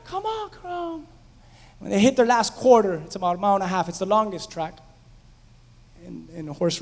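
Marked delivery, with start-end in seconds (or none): 0.0-1.1 screaming at the TV. Retold or imitated. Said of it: "And I'm screaming".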